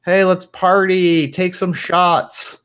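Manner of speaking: high-pitched, drawn-out party shout; drunk party girl. Acted. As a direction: egg them on like a tipsy girl. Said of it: "there are, like, some drunk girls".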